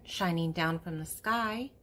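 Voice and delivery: normal talking voice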